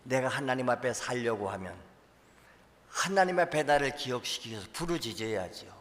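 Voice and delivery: ordinary talk, nothing marked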